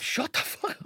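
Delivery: a dad's hushed warning; hissed mock threat. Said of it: "he was like".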